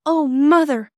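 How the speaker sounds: put-on loud, high-pitched child's call; excited cry. Bobby bursting into Mother's workroom, excited cry. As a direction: burst in crying out urgently. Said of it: "she cried".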